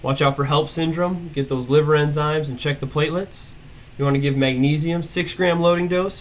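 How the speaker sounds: ordinary talk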